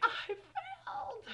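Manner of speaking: very high, slow, drawn-out wail; mock wail. Teen retelling failing the test, mock wail. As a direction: squeak it out like a little cry. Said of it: "So I was like".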